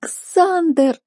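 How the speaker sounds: high, tearful wail; sobbing. Acted. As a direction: wail the name through sobs. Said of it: "сотрясалась от рыданий".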